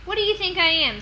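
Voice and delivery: high, loud indignant tooth fairy; sassy retort. Acted. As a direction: fire back, offended.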